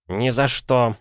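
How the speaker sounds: твёрдый отказ повыше; коротко и категорично отказать; отрезать. A narrator's reading. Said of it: "отрезал мистер Блинкхорн". (отрезать решительно)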